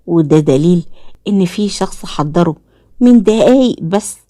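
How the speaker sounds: ordinary delivery, nothing marked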